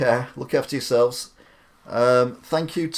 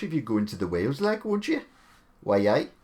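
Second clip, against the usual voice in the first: put-on failed Welsh accent. Streamer joking about pretending to be Welsh at the border, mock Welsh accent. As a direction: put on a bad sing-song Welsh accent. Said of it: "pretend to be Welsh".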